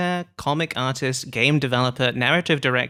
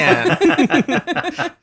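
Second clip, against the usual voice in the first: mock whoop; 4 dB louder than usual. Whooping along, country style.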